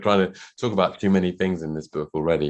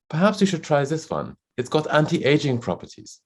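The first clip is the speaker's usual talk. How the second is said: 1 sales pitch; 8 semitones higher than usual. Retold or imitated. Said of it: "the sales assistant ventured something".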